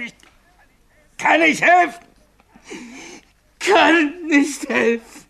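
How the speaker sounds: pleading, voice breaking in despair; near tears. Acted. Signalman breaks down to Bill, voice cracking near tears.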